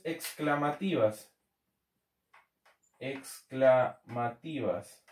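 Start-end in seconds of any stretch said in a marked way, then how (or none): 3.0-5.0 very slow and lower, syllable by syllable; slow dictation. Real, not acted. Teacher repeating a term as it is written, slow dictation. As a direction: break the word into slow, separate syllables.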